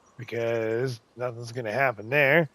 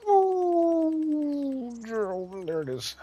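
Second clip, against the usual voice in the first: sudden high relieved oh, then slower; surprised oh; much slower than usual. Real, not acted. Player sees the edit land, high surprised relief. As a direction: catch the moment it finally works. Delicate terrain edit finally lands.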